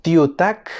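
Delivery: TIUTAK ending in a voiceless k; final K kept as k. Shown as a demonstration. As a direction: close TIUTAK on a clear K sound, not G. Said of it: "suena como K al final".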